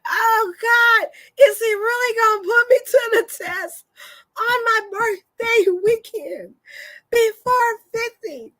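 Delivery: high-pitched laughing plea to God; mock desperate plea. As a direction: cry out half laughing in disbelief. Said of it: "And I'm like".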